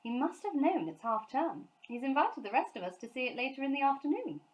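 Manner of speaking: lightly voiced mum; Mrs Brown. A narrator's reading. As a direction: explain kindly to the children.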